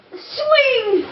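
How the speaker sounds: ordinary delivery, nothing marked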